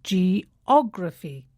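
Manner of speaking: geOGraphy, stress on the OG syllable, slow and clear; slow teaching demo, stress on OG; stress on GRA. Shown as a demonstration. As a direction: say geography with the weight on the second syllable. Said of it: "the stress falls on the third syllable from the end".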